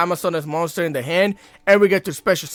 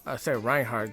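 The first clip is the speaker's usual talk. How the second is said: muttered; 9 semitones lower, 11 dB quieter than usual. Real, not acted. Correcting himself under breath.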